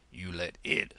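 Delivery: put-on low, puffing old frog; panting frog. Frog hobbling back to tree, low pant. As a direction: puff it out while hobbling. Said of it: "he panted out".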